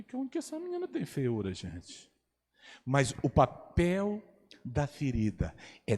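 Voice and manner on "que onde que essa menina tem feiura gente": astonished aside. Retold or imitated. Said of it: "eu falei".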